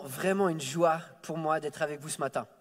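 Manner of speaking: usual speaking voice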